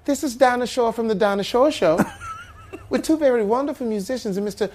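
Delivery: high, gracious TV hostess; high-pitched vintage show host. Acted. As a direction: introduce guests in a high, genteel voice. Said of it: "You hear".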